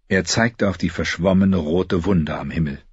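ordinary delivery, nothing marked

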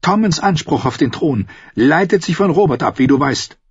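high, cutting woman's voice; sharp. A narrator's reading. Sister lecturing her brother on the throne, high sharp voice. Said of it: "Ihre Stimme klang scharf".

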